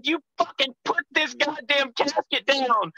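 high, strained mock yell; retold angry yelling. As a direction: re-enact an outraged order from inside a casket. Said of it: "I'm yelling inside this casket".

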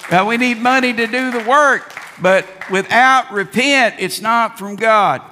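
high, loud fiery sermon; wrathful prophet. Real, not acted. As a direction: call them to repent with fire. Full-force preaching on repentance.

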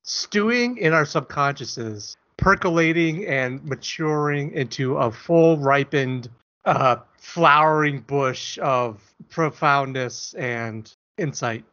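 slow, drawn-out lofty delivery; mock grandiose. Explaining the podcast name to his cohost, slow and grandiose. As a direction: savour each pompous word.

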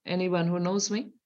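ordinary delivery

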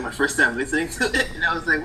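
ordinary talk, nothing marked

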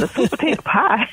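mock incredulous "a sweet potato pie?"; disbelieving. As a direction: echo the offer as if it's absurd. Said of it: "looked at me like I was, had lost my mind".

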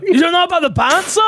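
high, loud disbelieving question; can't believe he doesn't know; shocked friend asks about The Bouncer; surprised. Real, not acted.

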